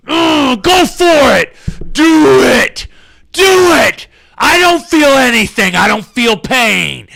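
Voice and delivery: high strained macho shouting; playing tough. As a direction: yell through the pain pretending it doesn't hurt. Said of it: "I'm trying to play tough".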